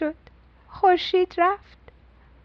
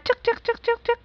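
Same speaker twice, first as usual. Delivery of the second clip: much higher squeak; squeaky rattle; 9 semitones higher, 4 dB quieter, much faster than usual. A narrator's reading. Papa shaking the baby's rattle, higher squeaks.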